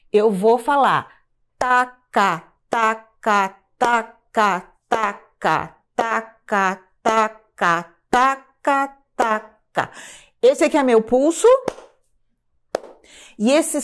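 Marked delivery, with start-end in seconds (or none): 1.6-9.9 "taca" as two even syllables on each beat; metronomic, evenly split "taca"; two sounds per pulse. Shown as a demonstration. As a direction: recite "taca" steadily, equal weight on both halves. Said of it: "dois sons no mesmo pulso".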